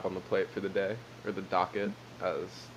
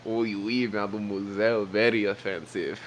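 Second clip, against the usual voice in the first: mock French accent, played for laughs. Co-host plays along with the bit, mock French accent. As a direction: answer in a put-on French accent. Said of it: "What about this French accent?".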